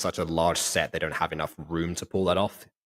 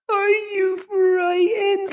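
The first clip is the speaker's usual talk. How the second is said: much higher, slow, drawn-out question; dramatic build-up; 5 dB louder, much slower than usual. Shown as a demonstration. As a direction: stretch it out high and trembling. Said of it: "it's a build-up".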